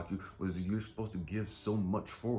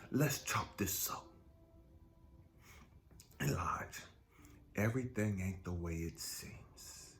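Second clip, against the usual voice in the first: slow soft reassurance; calm God voice; 3 semitones lower, 5 dB quieter than usual. Pretend. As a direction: soothe him slowly. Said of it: "I can hear God say".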